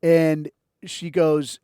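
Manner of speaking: usual speaking voice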